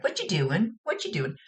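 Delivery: put-on playful child voice; little kid. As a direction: ask like a pestering little sister. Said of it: "something that the little kid would say".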